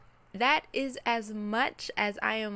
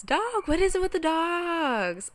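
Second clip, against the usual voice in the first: exasperated; 8 semitones higher, 7 dB louder than usual. Real, not acted. Dog barks interrupt her.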